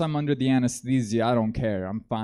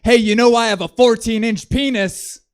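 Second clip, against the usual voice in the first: much higher, louder mock-angry bark; hammed-up furious boast; angriest tone; 10 semitones higher, 8 dB louder than usual. Stalker snapping at the silent girl, mock-angry bark. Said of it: "the angriest tone I think".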